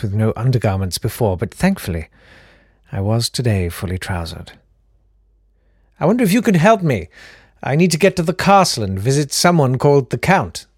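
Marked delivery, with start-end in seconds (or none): 6.0-10.7 a higher, quicker, eager asking voice; lightly voiced polite traveller. Traveller asking the barkeep for directions, higher eager polite voice. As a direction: ask the way brightly, a bit fast.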